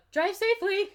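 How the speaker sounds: loud, high, chirped farewell; loud and high-pitched send-off; botched quiet line. A narrator's reading. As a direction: call it out far too brightly for a quiet line. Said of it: "he says quietly".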